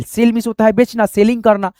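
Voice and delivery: normal talking voice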